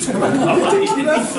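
repeating the governor's quip while laughing; laughing echo. Real, not acted. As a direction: echo his words through laughter.

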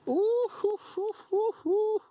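high mocking hoots; high hooting. Real, not acted. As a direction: hoot it up high.

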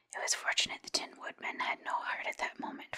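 plain reading voice

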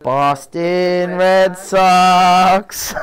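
slowly stretched, proud announcement; showing off the hat, very slow and proud; drawn-out brag. Real, not acted.

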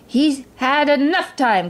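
lightly voiced impatient king, drawn out; exasperated cry. A narrator's reading. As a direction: stretch it out in exasperation. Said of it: "cried the king".

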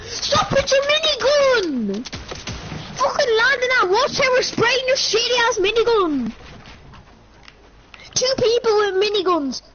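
high angry rant; raging gamer. Real, not acted. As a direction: vent the fury at them. Ranting after dying.

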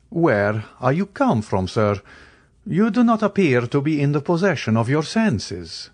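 lifted, cool aristocratic Count; Count's voice. A narrator's reading. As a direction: answer him with composed superiority. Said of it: "Count rebukes Albert".

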